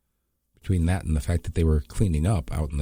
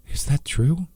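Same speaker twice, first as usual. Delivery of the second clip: a touch of fear, much higher pitch; shaken question; 10 semitones higher, slower than usual. Acted. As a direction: ask it still rattled from the fight. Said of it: "her voice still shaking from the fight".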